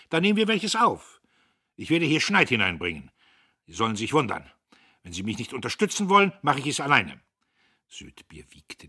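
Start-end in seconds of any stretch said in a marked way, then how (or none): 0.1-7.2 loud, higher blustering; young boss shouts at old clerk; angry shouting. A narrator's reading. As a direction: bluster and threaten. Dieterich keeps shouting.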